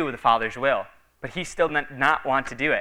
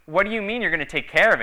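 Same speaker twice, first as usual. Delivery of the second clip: higher and fast, a mock panic; imitating a startled preacher's worry; retold alarm, quickly pitched up; alarmed question; 7 semitones higher, faster than usual. Preacher alarmed at God's words.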